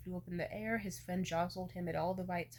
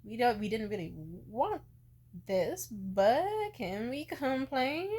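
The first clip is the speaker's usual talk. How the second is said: drawn-out, louder mock sigh of the parents, slowing right down; parents' rueful quote; 5 semitones higher, 9 dB louder, slower than usual. Parents shrugging off the surprise baby, mock rueful and slowed. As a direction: play the parents grumbling about a surprise baby. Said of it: "and they were like".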